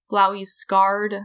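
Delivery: usual speaking voice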